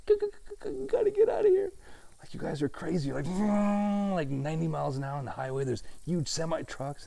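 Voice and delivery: high, frantic mock panic; a harried islander fleeing the mainland; frazzled panic. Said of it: "I'm like".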